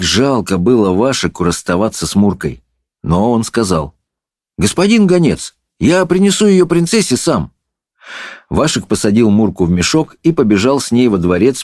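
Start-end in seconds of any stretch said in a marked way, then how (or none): 4.6-7.5 put-on high boyish voice; lightly voiced polite boy; boy, resolute. A narrator's reading. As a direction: answer the messenger in a high voice. Boy answers the messenger.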